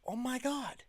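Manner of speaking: high, thrilled oh my God; a fan recognising the set; excited gasp. Retold or imitated. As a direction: squeal with fan delight. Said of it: "I'm like".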